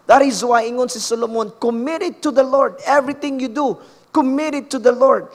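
fervent pulpit delivery; impassioned preaching. Real, not acted. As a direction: preach it with urgency, pitch held high, slowing on the final promise. Preacher builds to exhortation.

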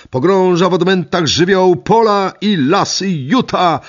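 slow, high, loud newsreel announcer; mock headline announcer. Narrator imagines the newspapers, grand slow headline voice. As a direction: proclaim it like a pompous front-page headline.

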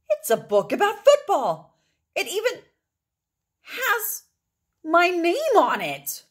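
high, loud rising amazement; excited child. A narrator's reading. Matt pulls a football book from the hat, high and thrilled. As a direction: gasp out the wonder of it.

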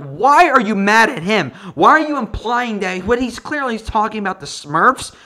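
ranting at the wife on screen; mock angry rant. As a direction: yell back at her scornfully. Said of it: "I'm like".

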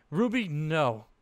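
panicked. A narrator's reading. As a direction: stop her in panic. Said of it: "panic clearly heard in her voice".

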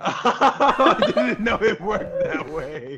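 slow words through real laughter; laughing. Real, not acted. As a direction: laugh through the whole line. Laughing apology.

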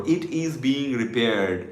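slow, measured teacher's model; slow model sentence. Shown as a demonstration. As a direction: say it slowly for learners to repeat. Repeat-along speaking drill. (ধীরে ধীরে শেখানোর ভঙ্গিতে)

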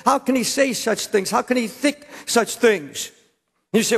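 usual speaking voice

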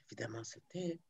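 a hushed whisper, barely voiced; the narrator whispers that the fisherman goes silent. A narrator's reading. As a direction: breathe it out in a whisper.

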